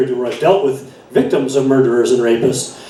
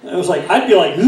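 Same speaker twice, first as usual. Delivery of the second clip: ordinary talk, nothing marked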